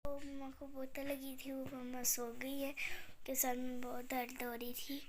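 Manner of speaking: soft whispered sign-off so mama can sleep; hushed goodbye to viewers. Real, not acted. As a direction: whisper so you don't wake mama. Mama asleep, girl whispers.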